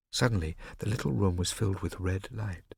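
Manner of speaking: ordinary delivery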